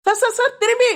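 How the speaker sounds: sudden high urgent calls of sir; alarmed call. Acted. Constable urgently warns the officer, alarmed high call. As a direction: warn him fast, voice jumping high.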